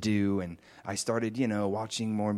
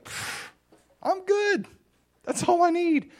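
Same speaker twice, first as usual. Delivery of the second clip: much higher, content and beaming; blissful relief. Retold or imitated. Believer answering God's love, high and blissful. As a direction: brush off every worry in pure joy. Overjoyed reply to God.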